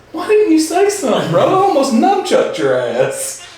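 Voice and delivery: high mock voice, slowing at the end; Will Ferrell's voice. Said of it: "He goes".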